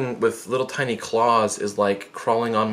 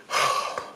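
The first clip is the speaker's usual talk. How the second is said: steadying puff of air; bracing breath; 5 dB quieter than usual. Retold or imitated. Victim getting ready for the pull, heavy exhale.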